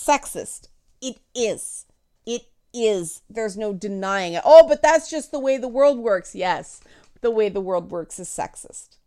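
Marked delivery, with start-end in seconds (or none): 4.4-6.3 louder, higher, shrugging excuse; mock dismissive objector. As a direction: toss off the excuse like a smug naysayer. Mocking an imagined objector.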